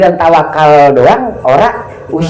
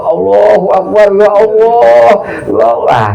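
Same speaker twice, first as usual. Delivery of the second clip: drawn-out, louder cry of panic to God; panicked prayer; 6 semitones higher, 4 dB louder than usual. Retold or imitated. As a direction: plead like a man on a boat in a storm. Man on small boat in storm. (doa panik, berteriak)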